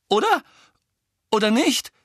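high, insecure rising question; unsure questioning. A narrator's reading. Ron seeking Harry's backing, uncertain rising voice. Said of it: "fügte er unsicher hinzu".

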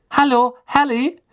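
high-voiced call down a corridor; high, questioning hail. A narrator's reading. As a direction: call after someone in a high voice. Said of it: "rief jemand im Korridor".

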